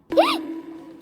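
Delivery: sharp, high intake of breath; shocked gasp. Real, not acted. Fan stunned by the youngest's photo, gasping.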